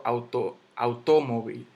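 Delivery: "automóvil" stressed on TO, au-TO-movil; mis-stressed "automóvil" as auTÓmovil; wrong stress on TO. Shown as a demonstration. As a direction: punch TO in "auto, automóvil". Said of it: "Yo no digo".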